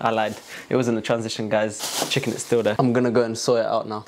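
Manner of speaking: usual speaking voice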